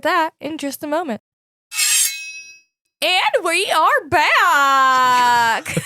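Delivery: very drawn-out, goofy DJ announcement; radio DJ voice. Real, not acted. Host welcoming listeners back after a break, goofy radio DJ voice. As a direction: stretch it out like a cheesy radio DJ.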